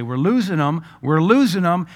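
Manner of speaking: mock urgent cry, building; retold alarm. Retold doctor's alarm in emergency.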